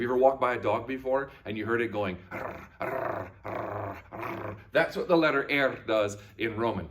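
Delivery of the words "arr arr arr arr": rolled-R dog growl; little snarling dog. Shown as a demonstration. Teacher imitating a dog heard going, trilled snarl. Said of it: "like a little snarling dog".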